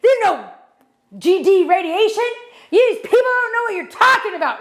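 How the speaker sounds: high-pitched mock yell at the mom; retold yelling. Airport agent yelling at the mom over the pat-down, high mock yell. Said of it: "like, yelling".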